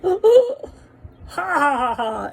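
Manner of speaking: loud burst of laughter that fades; cracking up. Retold or imitated. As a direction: laugh out suddenly in disbelief. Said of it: "he just cracked up".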